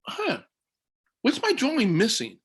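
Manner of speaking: high, playful teacher voice; sing-song teacher quizzing young children; teacher to kindergartners. Retold or imitated. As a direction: coax little kids with a bright high question. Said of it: "And I said".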